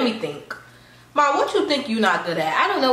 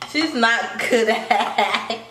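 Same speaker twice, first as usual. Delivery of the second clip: drawn out; 2 semitones higher, much slower than usual. Real, not acted. Stalling while thinking, drawn out.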